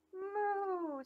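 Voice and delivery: high, playful moo; mock cow moo. Cow answers the duck, playful high moo.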